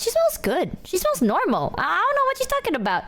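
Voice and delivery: high, swooping protest; emphatic defence. Real, not acted. Streamer defends her friend's smell to chat, emphatic.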